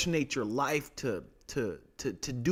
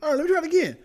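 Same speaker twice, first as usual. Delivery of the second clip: eager gambler; 7 dB louder, much faster than usual. Retold or imitated.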